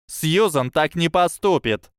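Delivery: exclaimed. A narrator's reading. Parvati defending Susan, high protest. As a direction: insist hotly. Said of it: "воскликнула Парвати".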